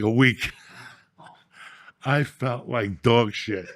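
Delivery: normal talking voice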